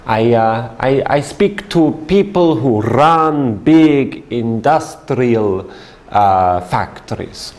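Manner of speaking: put-on light Italian accent, searching for words; halting, lightly accented Italian explaining his job; Italian businessman. Salesman explaining his work to his seatmate, light Italian accent. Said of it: "And he says".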